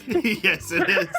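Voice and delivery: laughing through the words; high burst of laughter; real giggle. Real, not acted. Laughing about the cat.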